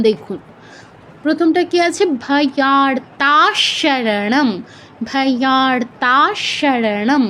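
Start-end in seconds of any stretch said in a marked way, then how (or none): 2.3-7.2 ভয়ার্তাশরণম very slowly, long aa and held sh; chant-like, stretched syllables in ভয়ার্তাশরণম; Sanskrit pronunciation demo. Shown as a demonstration. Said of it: "অনুস্বারের পর তালব্য স আছে". (ভয়ার্তাশরণম খুব ধীরে, তালব্য শ টেনে)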